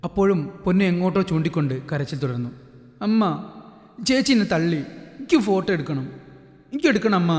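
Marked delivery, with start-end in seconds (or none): 3.1-7.3 a hint of a pouting little girl; slightly whiny child. Ponnu complains to mother, a touch whiny. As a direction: whine a little to mum. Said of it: "ഇത്തിരി കൊഞ്ചലോടെ".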